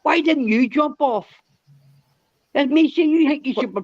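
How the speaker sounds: high-pitched, worked-up retelling of a row; retold heated rant. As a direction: replay the argument, voice climbing high with indignation. Retelling his rant at Mac.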